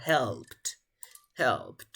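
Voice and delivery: "Helped" as one syllable ending in a T sound; model pronunciation. Shown as a demonstration. Teacher confirming Amanda's past tense answer, model pronunciation with final T. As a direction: say "Helped" clearly, closing on -pt. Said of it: "¿Cómo se pronunciaría en pasado".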